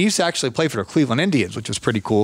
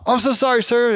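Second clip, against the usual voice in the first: sorry; 10 semitones higher than usual. Retold or imitated. Apologizing to angry old man.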